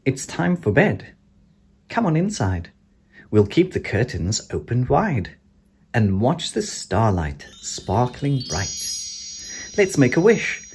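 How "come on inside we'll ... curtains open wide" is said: ordinary delivery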